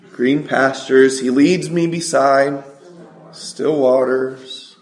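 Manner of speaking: psalm recitation. Real, not acted. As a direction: lead the congregation through it phrase by phrase.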